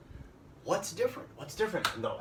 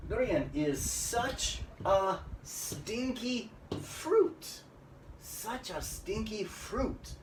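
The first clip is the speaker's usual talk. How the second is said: slow, high, stressed "such"; drawn-out "such a stinky fruit", pitched high; emphatic SUCH; 6 semitones higher, much slower than usual. Shown as a demonstration. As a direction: spell out the emphasis on "such", word by word. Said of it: "We want to emphasize these words".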